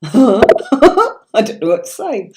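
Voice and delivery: bashful laugh through the words; giggling after a compliment; flattered laugh. Real, not acted. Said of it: "very rare accent and spoken so well".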